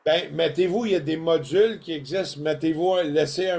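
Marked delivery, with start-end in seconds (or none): none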